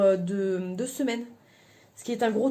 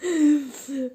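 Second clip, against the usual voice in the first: laughing; 4 semitones higher, 6 dB louder than usual. Real, not acted. Bursts out laughing at broken vacuum.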